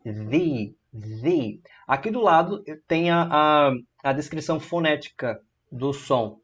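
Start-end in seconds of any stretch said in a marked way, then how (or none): 0.0-1.6 "The" said thee, long I sound. Shown as a demonstration. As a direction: repeat "the" as thee. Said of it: "puxa mais pro I".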